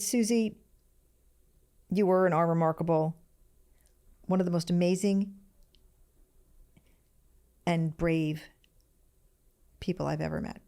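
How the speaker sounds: reading through held-back tears, long pauses; fighting not to cry while reading; holding back tears. Real, not acted. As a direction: voice catching on each phrase. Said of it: "I'm gonna try not to cry".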